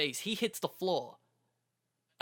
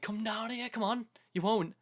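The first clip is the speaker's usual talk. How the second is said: retold shout; 8 semitones higher than usual. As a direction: call out a taunt without real volume. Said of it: "he went and shouted back to them".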